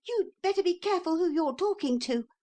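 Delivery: put-on a bold little boy squaring up; little boy threatening a horse, high and fast; small boy. A narrator's reading. Neville's voice, losing fear.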